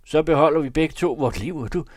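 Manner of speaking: high, loud, urgent poacher; bargaining poacher. Acted. (højlydt, indtrængende krybskytte)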